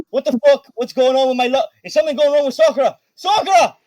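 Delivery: high, loud, alarmed yelling; Riz yelling out. Acted. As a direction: yell out in alarm for a missing friend, pitch way up. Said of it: "He fucking starts yelling out".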